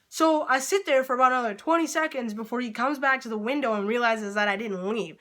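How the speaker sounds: ordinary delivery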